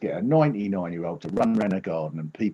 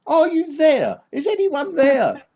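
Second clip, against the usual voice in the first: high, loud calling out into the void; screaming into a screen; 7 dB louder, slower than usual. Real, not acted. Comedian checking whether his online audience is there, real loud calling. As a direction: call out for any listener. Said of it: "I'm just screaming".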